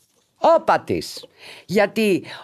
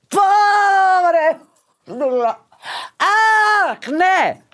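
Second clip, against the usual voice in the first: re-enacted delighted outburst, higher pitched; drawn-out mock squeal; retold excited cries; 11 semitones higher, much slower than usual. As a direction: cry out as if thrilled by the code. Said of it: "Έβαλα κάτι φωνές".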